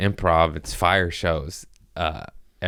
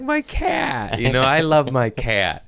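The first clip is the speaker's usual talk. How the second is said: high cooing pet voice; high baby talk to a beloved cat; 6 semitones higher than usual. Retold or imitated. Talking sweetly to his cat.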